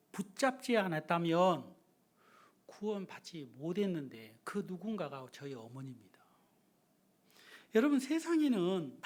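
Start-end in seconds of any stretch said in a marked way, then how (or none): 2.7-6.1 soft unvoiced testimony; whispered testimony. Real, not acted. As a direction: confide it softly. Confession whispered. (속삭이는 간증)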